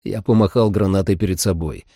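plain reading voice

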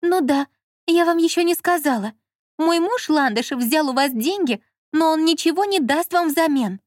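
high, heated woman's voice; Lola as wronged wife. A narrator's reading. Lola warning the director about her husband, aggrieved.

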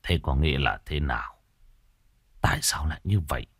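father stunned, mumbling to himself; stunned mumble. A narrator's reading. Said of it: "lẩm bẩm".

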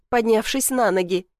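usual speaking voice

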